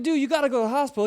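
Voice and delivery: fast, pushed, urgent insistence. Retold or imitated. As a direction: rush it, insisting in alarm. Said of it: "And I was like".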